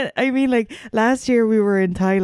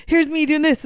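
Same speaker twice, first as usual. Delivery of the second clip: mock reenactment; 7 semitones higher than usual. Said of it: "I was kinda trying to reenact".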